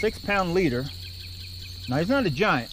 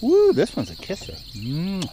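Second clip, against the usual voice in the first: ordinary delivery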